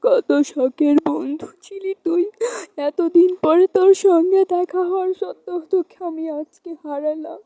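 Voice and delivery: high, sobbing lament; crying. Acted. Girl mourns her dying dear friend, crying. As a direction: wail in grief, breaking into sobs. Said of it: "কাঁদছিস কেন?".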